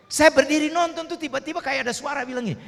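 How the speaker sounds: high, urgent storytelling; tense retelling. Retold or imitated. Pastor reliving the beating before the crowd, tense and urgent. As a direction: rush the panic of the moment.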